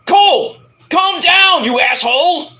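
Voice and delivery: imitated exasperated yell, high and loud; Corey Graves. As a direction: holler at a co-commentator, mock-angry. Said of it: "Corey Graves is like".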